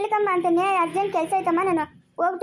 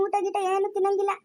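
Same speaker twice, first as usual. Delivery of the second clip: usual speaking voice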